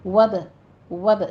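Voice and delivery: وب with lips springing open after b; short وب with a slight echo on b; qalqala bounce. Shown as a demonstration. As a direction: bounce the b lightly in وب. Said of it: "جلدی سے ہونٹوں کو کھولیے تاکہ قلقلہ ہو".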